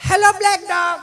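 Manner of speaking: prissy English dog-calling; mock call to dog. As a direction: coo at the dog like a fancy visitor. Visitor calling again.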